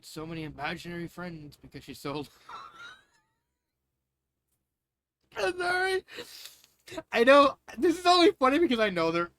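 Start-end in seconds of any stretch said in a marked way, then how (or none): 2.5-2.9 sudden squeaky laugh; high burst of laughter. Real, not acted. 5.4-9.3 laughing. Real, not acted. Laughing apology.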